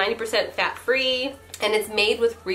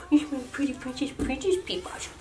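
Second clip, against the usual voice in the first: little voice for my dog; 4 semitones higher, 7 dB quieter than usual. Real, not acted. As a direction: coo sweetly at a pet. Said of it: "that's just my little voice for my dog".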